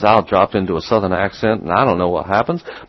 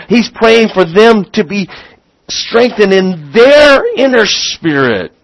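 preaching at full force; 10 semitones higher, 6 dB louder than usual. Acted. Preacher pressing the church with rising full preaching voice. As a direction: drive home the point like a revival preacher.

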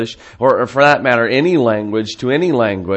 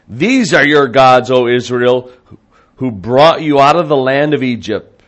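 raised, ringing announcement to a crowd; loud proclamation; 3 semitones higher, 7 dB louder than usual. Acted. The people presenting the golden calf to Israel, loud proclamation. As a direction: proclaim it loudly and higher.

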